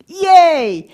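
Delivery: loud, high joyful yay; excited cheer. Real, not acted. Presenter cheers audience's correct answer, loud excited yay. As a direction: celebrate the right answer out loud.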